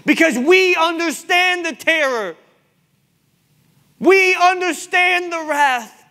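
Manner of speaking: impassioned rebuke from the pulpit; full-force preaching. Real, not acted. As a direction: press the congregation hard, stretching key words.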